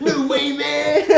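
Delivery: loud, laughing high-pitched squeal; voice gone squeaky. Real, not acted. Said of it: "Don't know what happened to my voice there".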